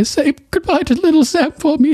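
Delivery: high, choked farewell on the edge of tears; about to cry. Retold or imitated. Sam leaving Gilly, high and close to tears. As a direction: voice cracking upward with sorrow. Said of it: "He's, like, about to cry".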